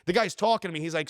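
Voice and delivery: ordinary talk, nothing marked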